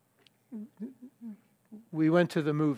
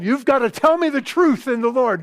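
louder, higher imitation of an insistent king; demanding; 7 semitones higher, 5 dB louder than usual. Ahab demanding the truth from the prophet, insistent. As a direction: insist on it, pitched up and forceful. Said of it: "who demands to be told the truth".

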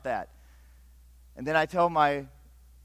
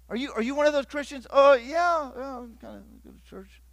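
high, then trailing into a sheepish mumble; mock awkward exchange; 10 semitones higher, 3 dB louder than usual. Preacher mimicking an awkward denial of faith. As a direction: play the embarrassed churchgoer dodging.

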